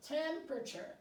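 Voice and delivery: ordinary talk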